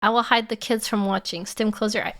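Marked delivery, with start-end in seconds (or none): none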